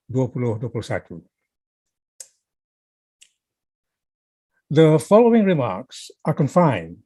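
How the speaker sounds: slow, deliberate newsreader delivery with long pauses; newscaster reading. Real, not acted. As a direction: read it out slowly like a newscaster. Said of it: "like a newscaster would, which is slowly and deliberately".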